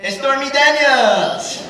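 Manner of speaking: high, loud, drawn-out reveal; slow, blasting name drop; shouted punchline. Acted. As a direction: yell the name like a big reveal.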